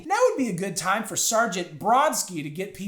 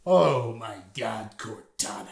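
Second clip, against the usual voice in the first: dramatic Halo voice; 9 semitones lower, slower than usual. Pretend. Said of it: "Say some Halo sh".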